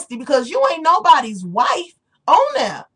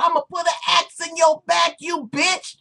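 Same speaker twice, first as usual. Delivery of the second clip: mock tough threat; 7 semitones higher than usual. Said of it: "they gotta talk about".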